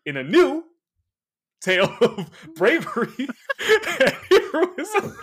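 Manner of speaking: reads a grand comic blurb, cracking up; mock-epic read that breaks into laughter; laughing through a blurb. Shown as a demonstration. Host reading the Conan blurb aloud to cohost, laughing. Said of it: "Here's your little adjective sentence".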